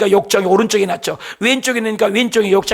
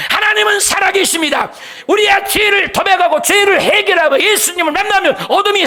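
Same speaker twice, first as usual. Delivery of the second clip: fiery preaching; 7 semitones higher than usual. Acted. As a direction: preach it out at full force to the congregation. Preacher's rising climax.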